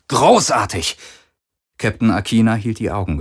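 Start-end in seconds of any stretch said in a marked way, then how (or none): 0.1-1.0 sarcastic exclamation. A narrator's reading. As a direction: bark "Großartig!" in frustration. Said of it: "rief er".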